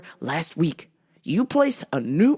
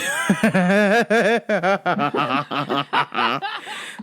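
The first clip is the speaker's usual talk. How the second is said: helpless extended laughing fit; real laughter. Real, not acted. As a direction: crack up at your own outburst. Laughs at own outburst.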